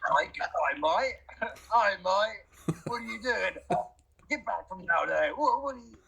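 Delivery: high-pitched mock Australian, sliding toward Cockney; attempted Australian accent. Shown as a demonstration. Guest doing an accent on the host's request, wobbly put-on Australian. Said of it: "I wanna hear your Australian accent".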